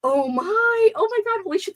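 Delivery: high, shocked exclamation; suddenly realising, voice jumps high; shocked gasp. Real, not acted.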